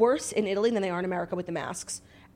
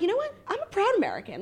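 higher, defiant mock pride; hammed-up patriotic declaration; mock proud; 11 semitones higher, faster than usual. Tourist mocking herself after rude waiters, mock proud. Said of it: "I'm like".

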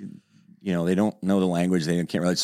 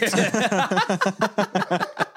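higher, squeezed out through laughter; laughing; 13 semitones higher than usual. Real, not acted. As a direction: say it while still cracking up. Laughing at own story.

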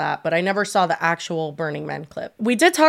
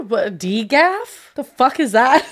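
shocked disbelief; 4 semitones higher, slower than usual. Real, not acted. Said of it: "What did you just say?".

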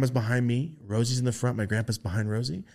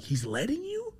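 incredulous; 4 dB quieter, slower than usual. Retold or imitated. Repeated incredulous thought.

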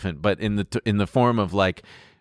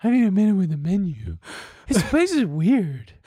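imitating weird date; 10 semitones higher than usual. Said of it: "this exactly how she sounds".

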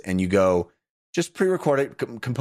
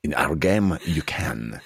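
mock game developer pitch; 8 semitones lower than usual. Podcaster mocks a developer selling his game, in a low suave pitch. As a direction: purr the sales pitch like a suave presenter. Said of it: "I'm really digging the".

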